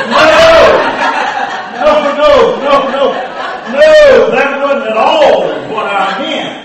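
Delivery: loud, high, horrified no; preacher horrified by the boy's answer, shouting no; shocked protest. Acted. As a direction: cry out in dismay. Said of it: "preacher protesting loudly".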